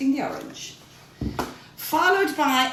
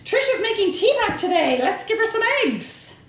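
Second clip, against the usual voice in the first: high, playful hen voice; voicing the hens; 8 semitones higher, 3 dB louder than usual. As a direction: chirp it like a busy little hen.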